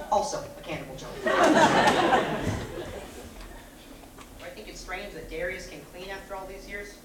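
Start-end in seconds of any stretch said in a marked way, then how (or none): 1.3-4.4 loud, high cackling laugh; loud cackle. Acted. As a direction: cackle at your own dark joke. Laughing at his own cannibal joke.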